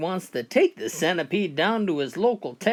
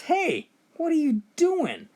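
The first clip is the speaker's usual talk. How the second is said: startled shout; 11 semitones higher than usual. Acted. Owner catches his dog, startled.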